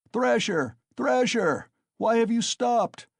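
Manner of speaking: loud, very high taunting yell; jeering shouts. A narrator's reading. Crowd urging Mikolka to keep hitting, jeering shout. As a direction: egg him on from the crowd. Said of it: "shouted voices in the crowd".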